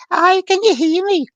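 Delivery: very high, loud Scottish lady; slow, piping Scottish call; Mary. Retold or imitated. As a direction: imitate a Scottish spirit calling through. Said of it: "a Scottish lady".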